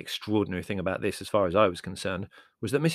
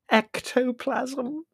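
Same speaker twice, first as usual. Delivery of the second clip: high, very slow wail; tearful; 4 dB louder, much slower than usual. A narrator's reading. Prue sure it was ectoplasm, tearful. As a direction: wail the word out in tearful horror. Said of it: "said Prue tearfully".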